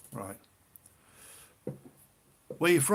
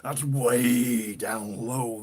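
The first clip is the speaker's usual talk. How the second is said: "way" stretched out long and loud; drawn-out emphasis; 6 dB louder, much slower than usual. Real, not acted. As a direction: stretch "way" right out in loud amazement. Amazed at very low guitar tuning.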